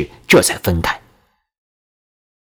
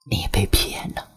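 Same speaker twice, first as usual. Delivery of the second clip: hushed whisper right by the ear; secretive whisper; 3 semitones lower, 9 dB quieter than usual. A narrator's reading. Girl warning a new student at his ear, whispered. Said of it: "在我的耳边小声说".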